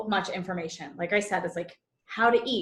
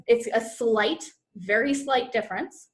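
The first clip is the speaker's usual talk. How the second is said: ordinary delivery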